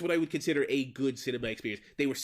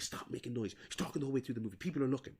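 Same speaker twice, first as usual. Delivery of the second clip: embarrassed hush; 6 dB quieter, faster than usual. Retold or imitated. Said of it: "She's like".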